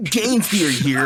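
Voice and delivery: loud, hammed-up YouTube intro; YouTuber intro impression. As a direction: announce it like a channel host. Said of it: "Welcome to movie theory".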